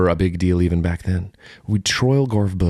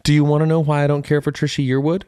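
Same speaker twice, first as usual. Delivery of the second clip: insistent; 8 semitones higher than usual. Real, not acted. Playful argument, defending himself.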